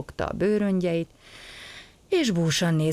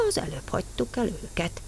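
usual speaking voice

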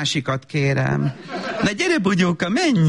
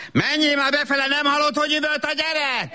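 exasperated; 9 semitones higher than usual. Acted. Mother snapping at husband.

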